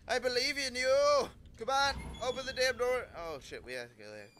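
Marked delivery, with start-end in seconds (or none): 0.1-3.1 urgent encouragement. Real, not acted. Urging on the character.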